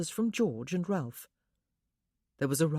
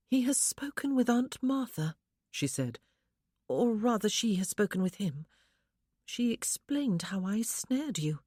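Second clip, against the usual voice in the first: lightly voiced young wife, higher, wistful; a wife lifted a little higher, yearning; young woman's voice; 5 semitones higher than usual. A narrator's reading. Wife tells husband her longing, lightly higher voice.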